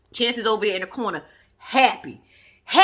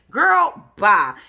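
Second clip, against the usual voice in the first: dismissive; 7 dB louder than usual. Real, not acted. Dismissive outburst at Taylor.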